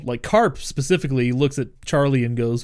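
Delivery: ordinary talk, nothing marked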